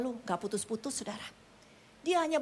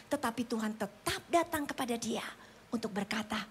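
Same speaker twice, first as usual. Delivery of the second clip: normal talking voice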